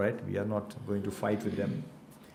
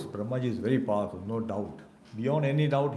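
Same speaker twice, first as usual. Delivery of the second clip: normal talking voice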